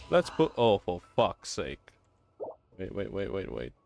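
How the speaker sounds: ordinary talk, nothing marked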